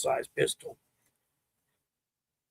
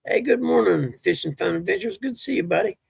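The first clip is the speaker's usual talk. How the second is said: cheerful greeting; 5 semitones higher than usual. Retold or imitated.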